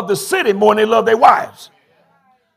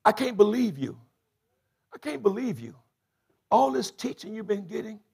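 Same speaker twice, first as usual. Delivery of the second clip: softer, disappointed sermon voice; hushed preaching; 3 semitones lower, 9 dB quieter than usual. Acted. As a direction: drop it down and chide them gently. Hushed scolding after climax.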